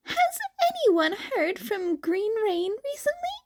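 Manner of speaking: high voiced chat member; high light character voice. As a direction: read the message in character.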